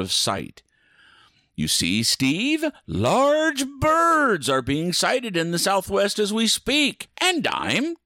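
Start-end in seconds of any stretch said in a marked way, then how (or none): 1.6-8.0 high, slow gloating villain; mad villain voice. Acted. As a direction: lord it over him like a mad god. Mock villain boast.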